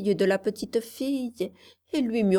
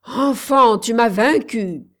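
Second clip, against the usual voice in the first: loud, higher witch's howl of defeat; defeated witch howling loudly; 3 semitones higher, 8 dB louder than usual. Acted. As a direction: cry out beaten and furious. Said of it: "hurla-t-elle".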